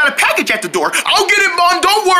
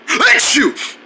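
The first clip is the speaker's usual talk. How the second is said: pretend sneeze. Wrinkle Head's lost sneeze returns, pretend sneeze. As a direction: burst out with a mock sneeze. Character has a sneezing fit.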